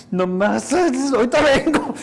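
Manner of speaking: a hint of a boy fleeing to cry; mock kid excuse. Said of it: "te golpeas y todo". (niño conteniendo el llanto)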